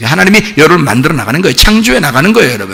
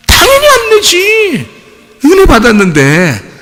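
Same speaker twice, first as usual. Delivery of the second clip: very high, drawn-out exclamation, then easing down; exclaimed retort; slower than usual. Acted. Preacher answering his wife's five-year test, high exclamation. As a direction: crow it as if utterly obvious.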